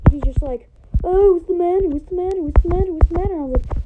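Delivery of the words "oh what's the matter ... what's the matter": sing-song teasing "what's the matter", pitched higher; mocking taunt. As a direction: taunt him like a smug friend. Friend taunting him in retold story.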